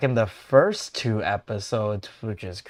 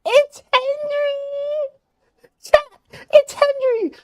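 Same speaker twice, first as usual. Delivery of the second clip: very high, loud, drawn-out squeal; ecstatic squealing; 11 dB louder, much slower than usual. Real, not acted. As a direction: scream with joy at a favourite character. Thrilled to see favourite character.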